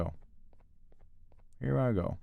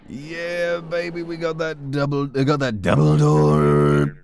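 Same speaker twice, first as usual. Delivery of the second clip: drawn-out celebrating cheer. Real, not acted. Player cheering himself on over the double door, drawn-out and hyped. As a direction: draw it out like a gleeful hype man. Excited celebrating a game find.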